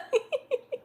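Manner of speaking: real high-pitched laugh; high giggle. Real, not acted. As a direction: giggle high at your own joke. Laughs at own joke.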